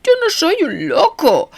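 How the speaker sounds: high, anguished, stress on yo; mean it with anguish; anguished sincerity. A narrator's reading. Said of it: "con una sinceridad angustiosa". (sinceridad angustiosa)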